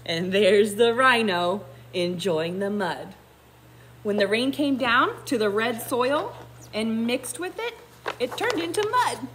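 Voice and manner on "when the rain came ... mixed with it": plain reading voice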